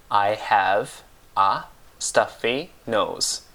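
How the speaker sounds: "I have a stuffy nose" with clear pauses between words; slow right down on "I have a stuffy nose", one word at a time; slow word-by-word model. Shown as a demonstration. Teacher repeats the English for a blocked nose, slow word-by-word "I have a stuffy nose".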